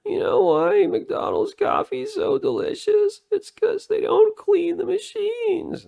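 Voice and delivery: very high, loud mock YouTuber falsetto; exaggerated squealing falsetto impression of a Chinese YouTuber. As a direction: imitate an excitable Chinese YouTuber, shrieking high. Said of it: "that Chinese YouTuber where he goes".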